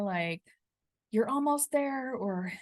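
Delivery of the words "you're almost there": pitched-up coach's cheer, not truly shouted; mock encouraging yell. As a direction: imitate urging someone to the finish. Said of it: "like, yell out".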